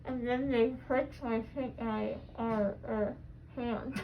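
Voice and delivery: groggy. Real, not acted. Loopy from laughing gas.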